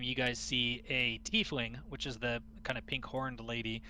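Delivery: normal talking voice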